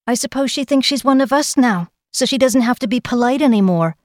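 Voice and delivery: high, clear, cultured voice; cultured, haughty boy. A narrator's reading. Page sneers at latecomer Kel, cultured and clear. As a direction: carry it across the room, snobbishly. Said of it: "Joran of Stone Mountain's cultured voice".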